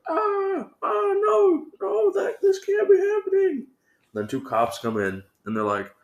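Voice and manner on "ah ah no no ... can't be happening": much higher shrieking lady voice, near crying; panicked old lady. Retold or imitated. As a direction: squeal it like a horrified lady. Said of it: "the lady's like".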